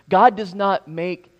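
normal talking voice

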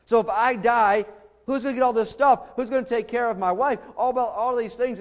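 agitated, high-pitched worrying; mounting worry. Acted. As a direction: fret out loud, pitch climbing as the what-ifs pile up. Acting Abram's mounting worry at night.

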